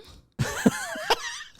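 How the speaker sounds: a loud, high burst of laughter. Real, not acted. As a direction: crack up at the mix-up. Laughing after answering "Mm.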